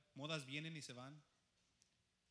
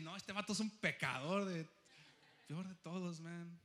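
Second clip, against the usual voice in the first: mocking accuser; 3 semitones higher, 7 dB louder than usual. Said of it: "Y van a decir".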